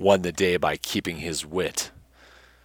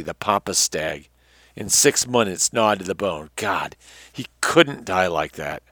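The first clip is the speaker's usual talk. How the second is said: dramatic, measured adventure-tale reading; story narration; 2 semitones higher, 7 dB louder than usual. A narrator's reading. Narrator reading an adventure tale to listeners, dramatic storytelling. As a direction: read it out with emphatic phrase openings and falling ends.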